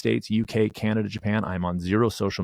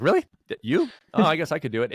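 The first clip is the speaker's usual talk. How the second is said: sharply higher, louder, mock disbelief; incredulous disbelief; 8 semitones higher, 4 dB louder than usual. Guest mimicking people who doubt he runs ultras, in incredulous surprise. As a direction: mimic people doubting him, pitch leaping up on "you". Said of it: "they're like".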